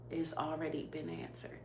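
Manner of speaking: a breathy whisper of reassurance. Retold or imitated. God reassuring her that the prayer is answered, whispered. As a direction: let it fall to a whisper. Retelling God's words in prayer.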